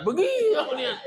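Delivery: high laughing call at the crowd; laughing shout. Real, not acted. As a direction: tease the congregation through a laugh. Teasing audience again, laughing.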